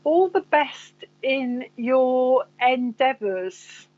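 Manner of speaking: very slow, each word laid out carefully; slow relayed message. Retold or imitated. Medium slowly repeating the spirit's words to the sitter. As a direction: pass on the message slowly and deliberately.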